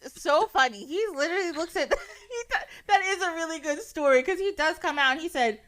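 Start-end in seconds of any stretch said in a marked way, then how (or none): none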